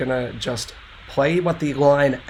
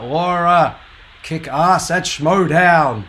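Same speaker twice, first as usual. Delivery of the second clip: excited shout-out; 4 semitones higher, 8 dB louder, slower than usual. Real, not acted. Shout-out to a friend.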